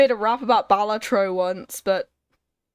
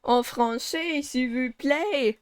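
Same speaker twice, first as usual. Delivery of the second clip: put-on French accent; 3 semitones higher, slower than usual. Asks the French character in French.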